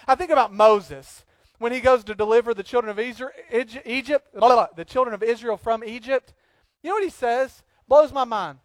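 usual speaking voice

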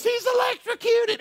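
retold frantic cry about the dog; frantic wife. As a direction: blurt it out in a panic.

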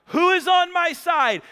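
high, loud rallying call; Jehu calling out for allies at full voice; acted shout. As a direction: shout it up to a window. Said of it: "saw her at the window and shouted".

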